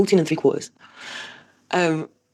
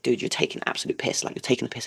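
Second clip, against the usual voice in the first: hissed under breath, much softer; whispered fury; 3 semitones lower, 5 dB quieter than usual. Retold or imitated. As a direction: seethe it in a whisper. Fuming at son ignoring calls.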